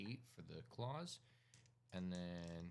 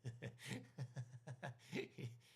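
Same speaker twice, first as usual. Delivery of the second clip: a high, unhinged cackle; eerie rising laugh; mad laugh; 6 semitones higher than usual. Acted. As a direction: cackle like a cult leader losing it. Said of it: "starts laughing".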